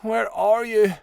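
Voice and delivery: high, plaintive call; moaning. A narrator's reading. Jade searches for her missing brother, moaning plaintively. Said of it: "Jade moaned".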